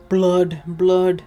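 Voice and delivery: loud eager repetition; excited. Real, not acted. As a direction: relish the gory word. Excited reaction to gore.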